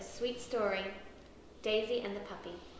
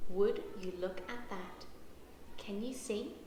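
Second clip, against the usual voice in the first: hushed; 4 semitones lower, 6 dB quieter than usual. Acted. Dad sees sleeping pair.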